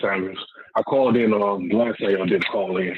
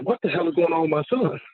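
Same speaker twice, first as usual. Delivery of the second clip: raised pitch, baffled retold question; alarmed disbelief; 7 semitones higher than usual. Father recalling his son spazzing out, alarmed disbelief. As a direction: replay a worried father's bafflement.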